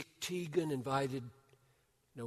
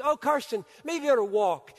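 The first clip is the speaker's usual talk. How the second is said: much higher, louder urgent call across the lawn; 10 semitones higher, 11 dB louder than usual. Retold or imitated. Host calling to the running boy, loud and high. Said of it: "leans out the door and says".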